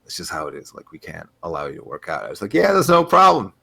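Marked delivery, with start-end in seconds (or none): 0.0-2.2 hushed, apologetic refusal; quiet, hesitant staffer backing into a no; gym staffer. Retold or imitated. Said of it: "and they were like". 2.5-3.5 high, louder breezy reply; easygoing brush-off; breezy agreement. Retold or imitated. Acts own cheerful reply to refusal.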